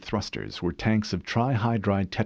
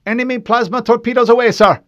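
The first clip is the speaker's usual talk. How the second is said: fast, loud alarmed report; urgent shout; 13 dB louder, faster than usual. Acted. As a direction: yell the warning fast. Officer shouting battle warning.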